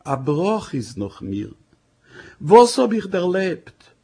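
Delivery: slow, high, loud tearful lament; bursting into tears. Acted. Wife bursts into tears over her fate, tearful lament. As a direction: cry the words out through tears. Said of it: "heraus in a Strom Tränen".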